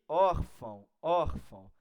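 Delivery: órfão with a loud, punched ÓR; stress on OR. Shown as a demonstration. Teacher pointing out the strong syllable, loud ÓR in órfão. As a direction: hit ÓR hard, let fão drop in órfão. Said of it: "Tá no or".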